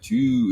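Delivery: "Chew" with the vowel drawn out long. Retold or imitated.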